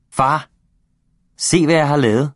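little Alfons calls to his busy dad, proudly; child calling out. A narrator's reading. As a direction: call proudly across the room to dad. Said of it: "råber Alfons".

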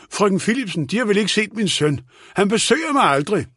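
put-on high-pitched, plaintive old man; complaining old man. A narrator's reading. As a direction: complain of being neglected by a son. Old man complains about son. (klagende gammel mand, højt toneleje)

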